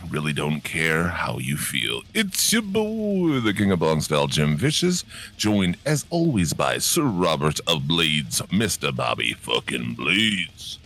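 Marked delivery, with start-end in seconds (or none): 2.1-10.8 slowed-down drawn-out intro voice; slow motion. Acted. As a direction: stretch every word like slow motion. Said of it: "are you talking in slow motion today".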